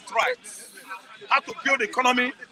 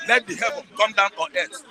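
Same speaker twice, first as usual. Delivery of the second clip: ordinary talk, nothing marked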